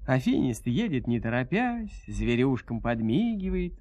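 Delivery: usual speaking voice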